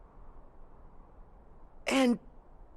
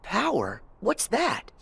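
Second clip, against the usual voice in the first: ordinary talk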